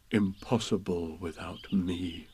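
quietly pleased with himself; proudly to himself. A narrator's reading. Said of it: "said proudly to himself".

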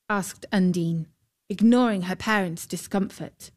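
ordinary delivery, nothing marked

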